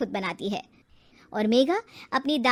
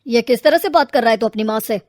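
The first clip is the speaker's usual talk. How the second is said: father scolding; 9 dB louder than usual. Acted.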